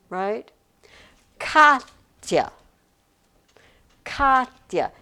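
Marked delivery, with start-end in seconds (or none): 1.4-4.9 "Katya" as KAHT-ya, first syllable loud, second dropping; stress on first syllable. Shown as a demonstration. Teacher scanning a student's name, "Katya" stressed on the start. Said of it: "you say the first one".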